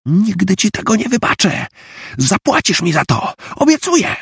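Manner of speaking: high, vengeful schoolgirl threat; high threatening girl. Acted. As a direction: swear revenge, shrill and spiteful. (mściwa groźba)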